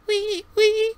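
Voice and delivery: high squeaking wee wee; squeaky wheel. Acted. GM imitating the droid's squeaky wheel, high. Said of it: "you hear the wee".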